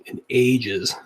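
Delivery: ordinary talk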